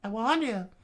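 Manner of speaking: high and icy; high cold threat. Acted. Robin threatens.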